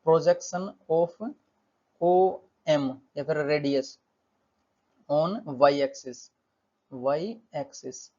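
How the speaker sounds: very slow, broken into small chunks; slow board-writing pace with gaps; dictating while writing. Real, not acted. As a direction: say it word by word as you write it. Saying words while writing them out.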